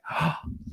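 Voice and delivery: a sharp, breathless intake of air; astonished gasp. Retold or imitated. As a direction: gasp in disbelief at the news. Awed at hearing Zig will meet.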